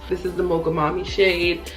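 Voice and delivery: usual speaking voice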